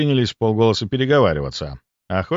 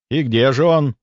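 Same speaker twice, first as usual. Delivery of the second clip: raised, higher mocking question; 4 semitones higher, 4 dB louder than usual. Narrator to the hunters about their missing beast, mocking. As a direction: ask it with a touch of scorn. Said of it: "с насмешкой спросил я".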